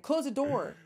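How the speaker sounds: ordering the visitor out of the office; sharp command. Retold or imitated. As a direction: bark it at him as he leaves. Retold ordering him to close door.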